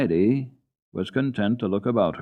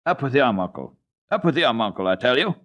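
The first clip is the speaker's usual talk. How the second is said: loud, irritated retort; 4 semitones higher, 4 dB louder, faster than usual. A narrator's reading. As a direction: snap back, fed up. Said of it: "with more and more irritation".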